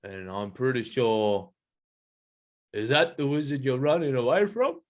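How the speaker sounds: lightly voiced doubtful gorilla; suspicious gorilla. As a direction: probe with a touch of suspicion. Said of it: "start getting a bit suspicious".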